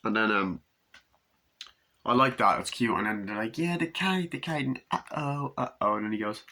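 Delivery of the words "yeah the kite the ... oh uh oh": put-on child's voice. Child voice in the kite scene.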